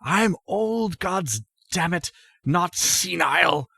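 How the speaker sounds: high, strained cry without real volume; mock old-man shout. Old man rebukes Melly, high restrained mock shout. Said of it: "He shouts at Melly".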